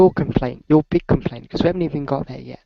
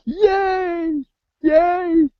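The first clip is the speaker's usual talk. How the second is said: cheering; 12 semitones higher than usual. Pretend. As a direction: cheer it with big excitement. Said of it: "you're not cheering".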